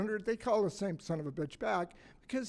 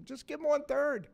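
higher, ironic joking tone; 6 semitones higher than usual. Pretend. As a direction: toss it off as a tongue-in-cheek joke.

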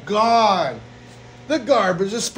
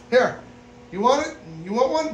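normal talking voice

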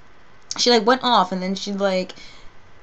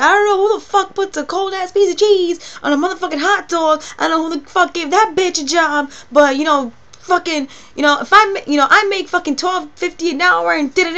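shrieking, much higher and louder imitated rage; friend going crazy; 10 semitones higher, 7 dB louder than usual. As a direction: explode like the friend losing it. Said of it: "she went crazy".

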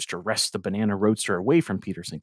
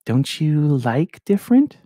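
slow, teasing flirty question; coy, drawn-out tease; 7 semitones higher, 7 dB louder, slower than usual. A narrator's reading. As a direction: draw it out with a flirty grin. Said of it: "gave me a flirty grin".

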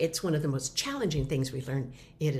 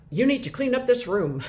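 louder, higher, laughing through the bossy order; a bossy parent order, played for laughs; laughing mock scold; 6 semitones higher, 7 dB louder than usual. A parent laughingly barking an order at a messy kid, louder. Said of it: "scolding a child".

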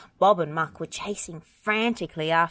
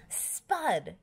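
mock yell, raised but soft; restrained yell; 4 semitones higher, 5 dB quieter than usual. A narrator's reading. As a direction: call the name crossly without full volume. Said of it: "yelled Farmer Pickles".

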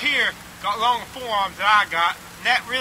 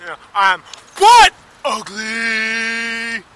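very slow, loud, sobbing shout; real anguish breaking into a slow sobbing yell; crying shout; 9 dB louder, much slower than usual. Real, not acted. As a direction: wail it out through tears, dragging every word. Self-loathing rant on camera.

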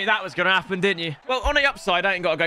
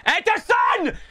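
shout; 10 semitones higher, slower than usual. Real, not acted. Gamer shouting at his keeper. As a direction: yell Ederson in protest.

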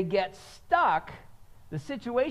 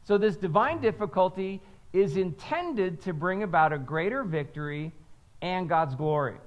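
normal talking voice

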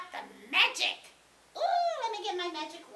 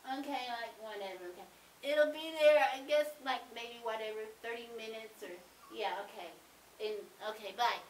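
put-on teenage girl; 10 semitones lower, faster than usual. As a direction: trail along like a teenager who couldn't care less. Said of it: "annoying little teenager-y girl".

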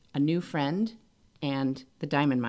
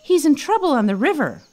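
frantic; 9 semitones higher, 6 dB louder than usual. Acted. Tom frantic, reporting danger.

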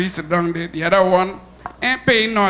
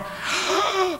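sudden high gasp as the plane drops; a quick scared gasp; sharp gasp; 12 semitones higher, 5 dB quieter than usual. Acted. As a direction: gasp in fright at the drop. Plane drops in air pocket.

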